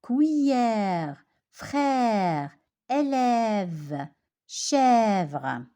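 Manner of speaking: Cuillère, frère, élève, chèvre, each with a long open è; open è demo. Shown as a demonstration. Teacher asks pupils to listen well, open è demo. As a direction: draw out the è in each word, letting it fall. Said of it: "Écoutez bien".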